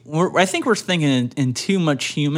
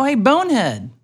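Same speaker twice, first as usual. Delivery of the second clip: mock taunt; 8 semitones higher than usual. Voicing cow insult.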